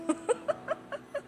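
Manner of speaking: sudden high-pitched burst of laughter. Real, not acted. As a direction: laugh out at your own joke. Tertawa setelah lelucon PIN ATM. (tawa melengking)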